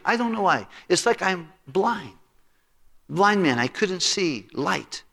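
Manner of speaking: high put-on voice, slowing toward the end; repentant friend. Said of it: "he said".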